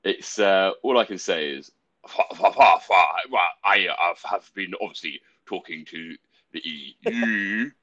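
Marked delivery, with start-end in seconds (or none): 2.1-7.7 stammering, blustering politician; Boris Johnson impression. Showing friends a very loose take on the prime minister, Boris impression. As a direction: splutter into it, then slow right down. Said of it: "I do a loose one".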